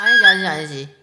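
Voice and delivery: firm correction. Real, not acted. Coach correcting the take.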